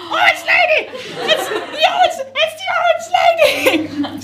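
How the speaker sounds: recognise her in the photo and shriek; laughing high-pitched shout; excited shout. Retold or imitated. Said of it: "I was like".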